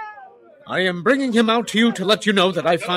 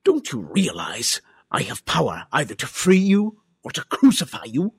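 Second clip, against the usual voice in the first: mildly indignant, insistent questioning; asserting authority; 3 semitones higher than usual. Acted. Pilate warns Jesus of his power, insistent. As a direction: press your power on the prisoner.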